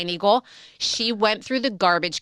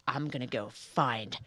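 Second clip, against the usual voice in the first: determined friend; 6 semitones lower, 6 dB quieter, slower than usual. Retold or imitated. Friend's determined vow voiced.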